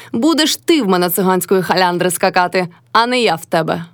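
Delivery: usual speaking voice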